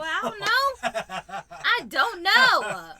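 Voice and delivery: loud, high, drawn-out slow words; mock exasperation. Fed-up mom to questioning child, mock exasperation. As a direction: stretch it out like a fed-up mom, hammed-up.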